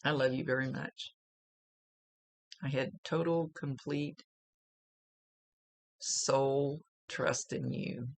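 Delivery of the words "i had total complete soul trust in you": slow, choked and soft; fighting tears through each word; holding back tears. Real, not acted. Medium passing on a mother's words, near tears. They make me cry.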